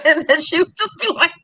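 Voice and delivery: ordinary delivery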